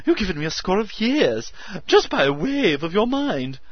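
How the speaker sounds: high loud frantic joy; hysterical excitement. A narrator's reading. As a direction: burst with manic excitement. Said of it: "excitement to his hysteria".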